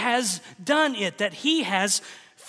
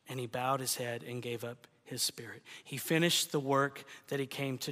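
much softer, lower, near-whispered sermon voice; hushed preaching; 6 semitones lower, 7 dB quieter than usual. Acted. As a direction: drop to a hush over the cross.

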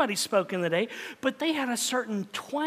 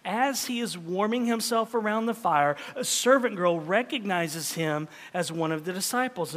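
ordinary talk

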